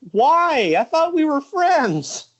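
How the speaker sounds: high, drawn-out plaintive cry; mock betrayed wail. Wrestler hit by a friend's racket, hammed-up hurt wail.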